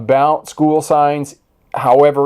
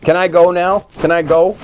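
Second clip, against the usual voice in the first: higher, louder whiny dog voice; pleading dog; 5 semitones higher, 4 dB louder than usual. Pretend. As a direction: lightly voice the dog begging to be let down. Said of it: "He's like".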